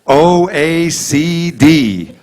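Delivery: very slow, letter by letter; reading eye chart. Pretend. Patient at eye exam reading the chart, very slow. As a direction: spell out "O A C D" one letter at a time, very slowly.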